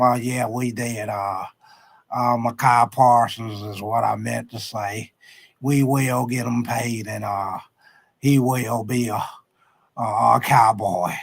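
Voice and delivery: a Texan team owner choosing each word slowly; Jerry Jones impression. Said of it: "my Jerry Jones impersonation".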